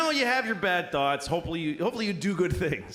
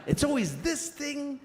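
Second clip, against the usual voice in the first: markedly higher-pitched, earnest delivery; virtuous high voice; 8 semitones higher than usual. Shown as a demonstration. Showing the thought he believes, high and earnest. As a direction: lift the voice for the good thought. Demonstrates good thought against bad one.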